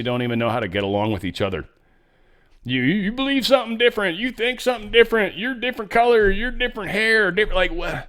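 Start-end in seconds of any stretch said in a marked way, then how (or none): 2.6-8.0 high, rapid mock outrage; mocking incredulity. Commentator mimicking people who hate over differences, mocking incredulity. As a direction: parody petty prejudice in a high-pitched rush.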